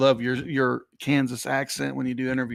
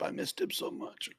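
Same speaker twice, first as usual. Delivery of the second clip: breathy, near-silent mock sob, much softer; mock choked up; 6 semitones higher, 13 dB quieter than usual. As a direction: pretend to be too moved to speak. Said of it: "Hold on, I got choked up".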